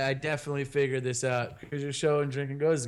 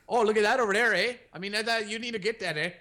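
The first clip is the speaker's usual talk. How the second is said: mock Canadian accent; 5 semitones higher than usual. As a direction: ham up the Canadian "eh?" at the end of each line. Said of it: "I was talking like that last night".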